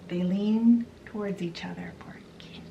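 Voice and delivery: a playful hushed aside; hushed whisper. Retold or imitated. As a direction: whisper it like a secret moment. Romantic kiss moment in retelling.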